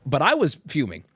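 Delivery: ordinary talk, nothing marked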